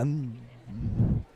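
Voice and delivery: ordinary talk, nothing marked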